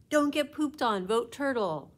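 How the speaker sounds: high, loud campaign slogan; poster slogan shout. Acted. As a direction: call it out like a rally chant. Reading pro-turtle poster aloud.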